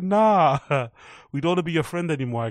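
mocking rejection. Kids rejecting him coldly.

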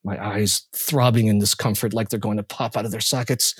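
ordinary delivery, nothing marked

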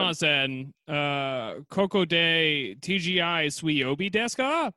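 put-on Japanese spoken with a thick American accent; no effort to sound Japanese. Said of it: "zero effort in your Japanese accent".

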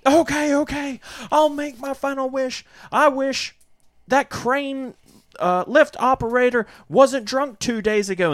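high, urgent final wish; mock frantic young woman; desperate heroine. Alex making her final wish, high and urgent. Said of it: "she's like".

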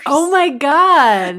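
loud, high, drawn-out amazement; gasp-worthy news, react big and slow; real astonishment. Real, not acted. Cohost hearing of the on-camera win, astonished.

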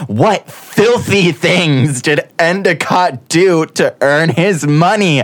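high, slow, hammed-up gossip; milk each word like a scandal; mock dramatic. Hamming up Endicott's dark secrets.

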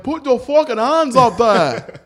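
loud, high, drawn-out Scottish imitation; mock Scottish accent. Imitating a Scottish fighter for friends, loud mock Scottish. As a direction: holler it slowly in exaggerated Scottish. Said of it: "that's like a Scottish thing".

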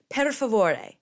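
"Per favore" high and loud, ending in a clear "-ay"; corrected Italian pronunciation. Shown as a demonstration. Correcting herself to Italian, "Per favore" with final "-ay". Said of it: "I just messed up".

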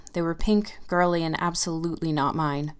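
usual speaking voice

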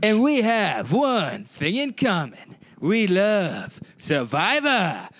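slow, high, sing-song show intro; hammed-up announcer. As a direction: announce it like an epic reality-show opener.

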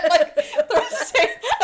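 high, loud, laughing through words. Real, not acted. To a friend, recounting the scene while laughing. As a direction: talk through real laughter.

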